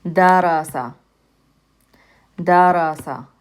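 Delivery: Darasa with three quick, short a sounds; short vowels demo. Shown as a demonstration. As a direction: keep every a in Darasa brief. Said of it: "They're short vowel sounds".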